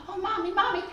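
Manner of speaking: put-on high, loud, urgent child's plea; interrupting child. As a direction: butt in like a child clamoring for mommy. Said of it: "somebody comes in and says".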